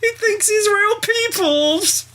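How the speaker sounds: very high, slow, gushing amazement; hammed-up squeal of wonder; mock amazed onlooker. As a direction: coo like a delighted child at a trick. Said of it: "It's like the novelty of".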